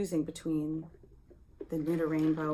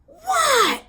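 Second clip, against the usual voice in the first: high startled yell; alarmed shout; 11 dB louder than usual. Retold or imitated. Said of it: "she was like".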